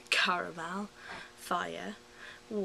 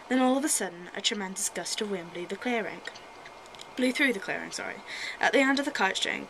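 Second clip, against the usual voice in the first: put-on reading a children's story aloud; lively storybook reading; story narration; 6 dB louder than usual. Reads a Winnie the Pooh story.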